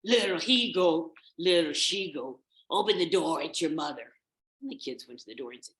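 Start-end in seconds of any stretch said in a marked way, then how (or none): 0.0-4.1 loud wolf chanting the song; forceful, badly disguised wolf. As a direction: belt it out as a big bad wolf. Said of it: "in his best wolf voice".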